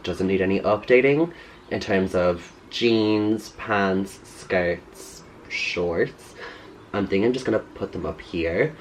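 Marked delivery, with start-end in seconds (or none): none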